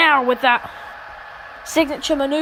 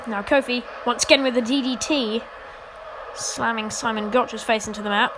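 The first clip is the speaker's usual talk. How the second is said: ordinary talk, nothing marked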